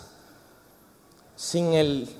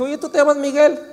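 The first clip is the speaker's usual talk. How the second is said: mock greeting; 9 semitones higher, 4 dB louder than usual. Acts out greeting Miguel.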